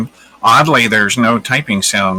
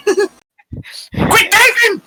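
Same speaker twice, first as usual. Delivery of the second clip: drawn-out, laughing, high yelled Quit typing; mock shout; much slower than usual. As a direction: bellow it slowly through laughter.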